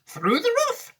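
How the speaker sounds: high, sprightly storybook Wonka; a bright showman answering; teacher reading Wonka's line to the class, high and lively; lightly voiced Mister Wonka. A narrator's reading.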